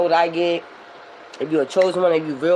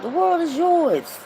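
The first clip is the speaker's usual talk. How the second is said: encouraging; 13 semitones higher, slower than usual. Real, not acted. Encouraging followers.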